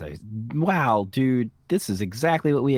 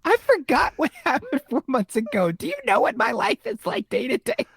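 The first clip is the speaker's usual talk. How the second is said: defensive; 13 semitones higher than usual. Acted. Mock defensive outburst.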